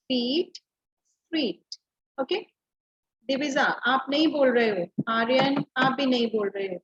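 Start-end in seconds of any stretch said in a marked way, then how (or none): 0.1-1.7 फीट and स्ट्रीट drawn out slowly; rhyming words demo. Shown as a demonstration. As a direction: say फीट, स्ट्रीट slowly so the matching sound rings. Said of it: "सब बोल के देखेंगे loudly". (धीरे-धीरे फीट, स्ट्रीट का उच्चारण)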